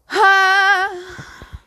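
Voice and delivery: long, high-voiced sighing "hah"; exasperated sigh. Real, not acted. Exasperated pause in rant.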